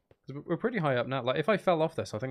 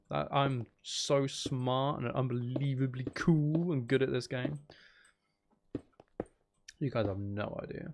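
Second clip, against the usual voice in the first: sarcastic boast; slower than usual. Pretend. Self-mocking sarcastic boast.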